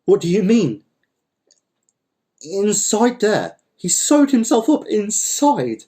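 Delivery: high, drawn-out incredulity; stunned disbelief. Acted. Don Lol hearing of the man in the jar, stunned. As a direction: stretch the words in sheer astonishment. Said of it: "He couldn't believe it".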